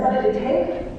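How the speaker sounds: ordinary delivery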